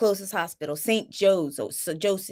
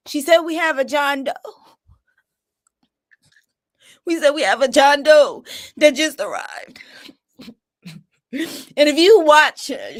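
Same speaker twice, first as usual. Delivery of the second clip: higher, louder voice breaking into crying; tearful; 5 semitones higher, 5 dB louder than usual. Real, not acted. Mother retelling the John Doe call, tearful and loud. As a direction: relive the dreaded news, close to sobbing. Relives news of son's possible death.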